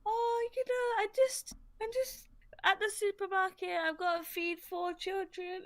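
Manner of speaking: high-pitched mock supermarket chatterer; chatty northern lady. Imitating a lady who chats to strangers in Tesco, high chatty voice. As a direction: put on a high, oversharing shopper voice. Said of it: "and this lady goes like".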